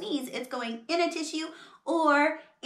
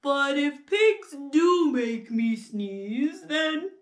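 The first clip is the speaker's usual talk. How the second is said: slow, building to a high wail; acted despair, rising higher and drawn out; melodramatic distraught elephant; 7 semitones higher, 4 dB louder, slower than usual.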